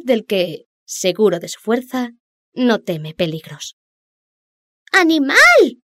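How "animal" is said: high, loud fish shout. Acted. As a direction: yell it to wake him. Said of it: "le gritaba el pececillo".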